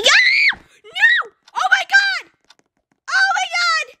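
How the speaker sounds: real panic outburst; panicked yelling. Real, not acted. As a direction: yell as the water hits. Dying in the flood.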